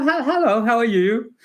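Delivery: high-pitched British greeting; very British accent. Retold or imitated. Young interviewer greeting a stranger in the lobby, very British. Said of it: "In my very then British accent".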